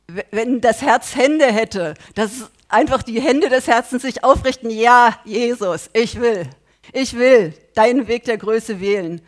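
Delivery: loud, driving sermon voice; fervent preaching. Acted. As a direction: preach it hard to the congregation. Preaches with rising force.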